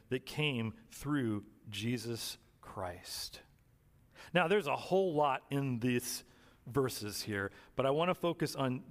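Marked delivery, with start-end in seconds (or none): 0.1-3.4 slow whisper to end the verse; whispered close. Real, not acted. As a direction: let "that came through Jesus Christ" fade to a slow hush. Ends scripture reading in whisper.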